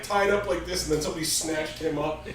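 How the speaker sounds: usual speaking voice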